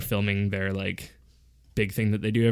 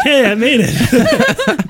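high, loud, drawn-out retold cheer through laughter; mock triumph; 9 dB louder, much slower than usual. Bearded audience member singled out by comedian, gleeful. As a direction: whoop it slowly while laughing.

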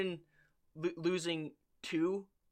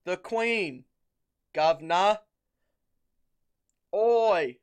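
loud, higher cockney-ish demand for tea; mock British accent; 4 semitones higher, 10 dB louder than usual. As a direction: play a rude British caricature. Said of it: "British people stereotype".

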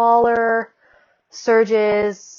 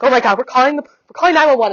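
panicked; 5 semitones higher, 7 dB louder, faster than usual. Retold or imitated. Mom panicking at labor.

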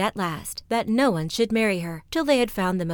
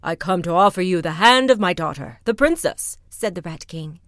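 loud, puffed-up proclamation; pompous rat king, louder; proud rat king; 7 dB louder than usual. Acted. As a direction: boast of the princess grandly. Rat King proposes to cloud.

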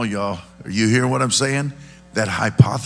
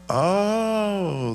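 long high "oh" of realization; dawning realization; 11 semitones higher than usual. Acted. Listener finally getting the story, dawning realization. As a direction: let the penny drop, pitched way up. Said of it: "the more you're like".

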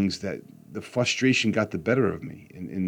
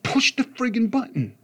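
much higher, exasperated retold yell; angry yell. Frustrated dad yelling at his son, high and exasperated. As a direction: hit friggin' hard, fed up. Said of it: "So I'm yelling at him".

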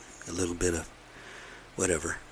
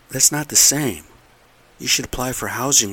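frustrated; 5 semitones higher, 7 dB louder than usual. Real, not acted. Frustrated rant about family.

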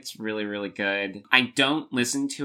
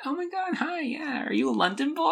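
much higher, breathless fangirl squeal. Retold or imitated. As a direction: gush like a starstruck pop star. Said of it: "And she'd be like".

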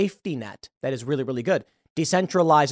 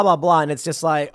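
ordinary talk

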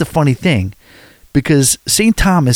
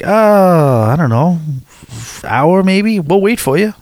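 higher-pitched, hesitant retold reply; lightly voiced easygoing vendor, pitched up; imitated jet ski guy; 5 semitones higher, slower than usual. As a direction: mull it over in a raised voice, drawing out the words.

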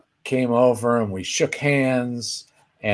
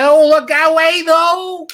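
much higher, louder, slow goofy squeal; squeaky Jerry voice; 6 dB louder, slower than usual. Retold or imitated. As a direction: go way up into a cartoonish comic squeak. Jerry Lewis gag impression.

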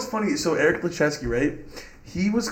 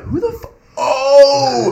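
high startled cry cut off mid-word; sudden high yelp of surprise; shocked outburst; 9 semitones higher, 9 dB louder, much slower than usual. Retold or imitated. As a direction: jolt up in shock. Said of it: "I'm like".

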